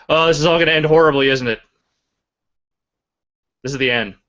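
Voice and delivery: fast, loud mock panic; hammed-up doom, rushed and loud; mock dread. Real, not acted. Stream freezing, mock doom.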